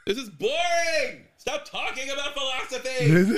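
high, whiny mock complaint at the screen; mock bored viewer yelling. Bored moviegoer yelling at the screen in a high whiny voice. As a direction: whine at the movie to get back to the action. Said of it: "you're just like".

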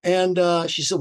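usual speaking voice

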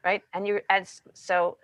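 usual speaking voice

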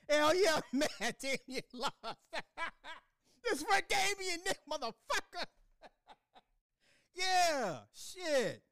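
laughing high through the words. Real, not acted. Gloating over Damien losing, laughing high. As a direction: cackle it out, giddy.

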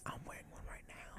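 very soft whisper; whispered aside. Real, not acted. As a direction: whisper it under the other speaker. Aside during the guest's plug.

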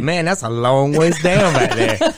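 slow, loud, laughing taunt; teasing. Retold or imitated. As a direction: rub in the danger with a grin. Teasing the scared adjuster.

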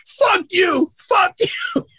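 very high, screeching imitation of a yelling player; mock the screaming ballplayer; shrill mock yell. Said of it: "I hear Albert Bell yelling".

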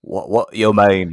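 slow mock Australian accent; puzzled Australian bloke; jokey Australian accent. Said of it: "Australian accent".

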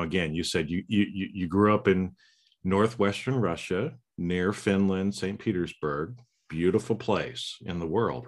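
ordinary talk

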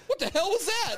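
high-pitched retold alarm; retold panic. Man startled by knocking on the window, retold panic. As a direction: blurt it out in mock panic. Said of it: "If this guy's panicking".

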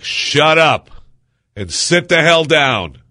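high, loud barked order, slowing at the end; angry shout. Retold or imitated. Passengers yelling at a rowdy man on the plane, furious. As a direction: yell it down the aisle at the rowdy one. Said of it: "and people are like".

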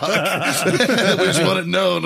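slow, loud, high through laughter; laughing formal declaration. Retold or imitated. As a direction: drag out the proclamation while cracking up. Marines formally accusing head table.

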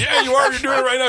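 high, loud mock insistence; teasing wife. Voicing the wife teasing husband.

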